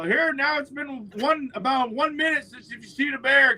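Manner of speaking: playful mock announcement; retold gloat. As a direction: crow it at the doubter. Retold taunting of doubting neighbor.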